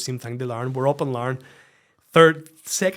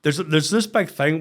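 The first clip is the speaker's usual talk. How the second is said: ordinary talk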